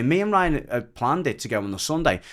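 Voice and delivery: ordinary talk, nothing marked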